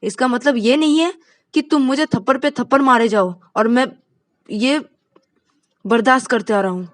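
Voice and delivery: loud, high, fed-up complaint; angry outburst. Acted. Angry man scolds after slaps. (गुस्से में ऊँची आवाज़)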